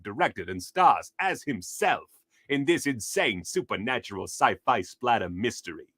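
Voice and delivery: dramatic promo read with swooping pitch; blurb read. Acted. Film fan reading out a movie blurb, dramatic promo read. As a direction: read it like hyped trailer copy.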